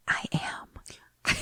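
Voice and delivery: breathy whisper, barely there; whispered reply. Retold or imitated. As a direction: whisper it as if speaking with the mind. Coach answers silently, mind-speaking.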